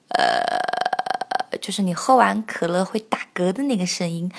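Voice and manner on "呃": low creaky burp-like 呃 in pure vocal fry; vocal fry croak. Shown as a demonstration. Teacher demos vocal fry with a creaky 呃. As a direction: croak 呃 like a burp after cola. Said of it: "并且会有气泡音".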